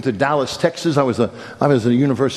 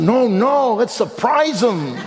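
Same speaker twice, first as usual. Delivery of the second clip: higher, slower, gleeful with a laugh; giddy dad sure of his plan; gleeful insistence; 9 semitones higher, slower than usual. Retold or imitated. Eager dad insists on surprise.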